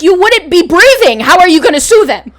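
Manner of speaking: loud, disbelieving retort; exasperated. Retold or imitated. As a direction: push back hard, incredulous. Exasperated reply to mom.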